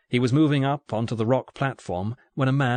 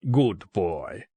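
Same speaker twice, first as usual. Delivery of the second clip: put-on lower, patronising Russian accent; thick Russian accent; 4 semitones lower than usual. A narrator's reading. Russian gunman to an obedient captive, thick Russian accent. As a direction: approve condescendingly, heavily Russian-accented. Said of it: "in a thick Russian accent".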